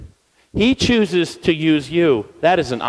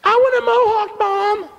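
put-on high child voice asking mom; imitating a kid. Boy asking his mom for a mohawk, high child voice. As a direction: pipe up like an eager little boy. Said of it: "he's like".

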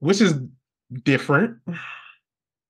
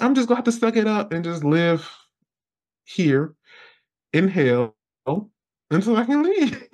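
mock resignation; 8 semitones higher than usual. Resigned self-talk.

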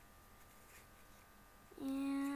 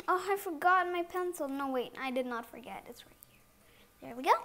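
ordinary talk

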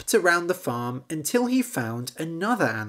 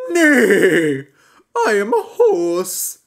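loud, higher horse, chuckling; 5 semitones higher, 10 dB louder, slower than usual. Acted. Horse introducing itself to frog, loud. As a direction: boom out as a jolly horse. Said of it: "horse speaks".

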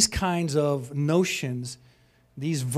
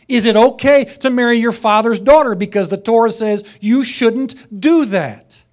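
louder, higher fervent preaching; full-force preaching; 5 semitones higher, 10 dB louder than usual. Acted. Preacher to congregation on Torah rules, fervent preaching. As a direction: hammer each point home from the pulpit.

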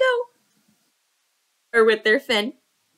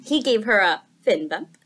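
usual speaking voice

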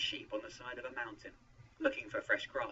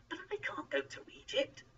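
high-pitched, worried protest. A narrator's reading. Moses objects to going to Egypt, protesting. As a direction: protest high, not yet at full volume. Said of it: "Moses protested".

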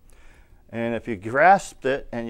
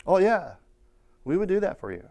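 lightly voiced, higher agreeable reply; a hint of an obliging tradesman, pitched up; agreeable press man; 6 semitones higher than usual. Printer agreeing to monthly payments, agreeable and higher.

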